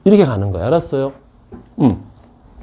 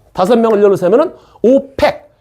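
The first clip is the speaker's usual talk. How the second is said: ordinary talk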